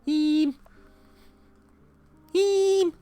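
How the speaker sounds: very high, loud mock Scouse; hammed-up high-pitched Liverpool accent; Liverpudlian accent. Shown as a demonstration. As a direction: squeal out an exaggerated Scouse. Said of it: "is a Liverpoolian accent".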